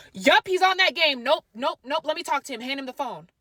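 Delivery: a loud, high mom voice; mom's voice. Retold or imitated. Telling mom on the phone to hand him over, loud imitation. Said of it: "She goes".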